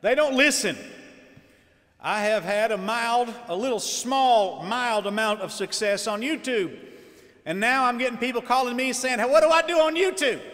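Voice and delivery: preaching at full force. Acted. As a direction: preach it out hard to the congregation.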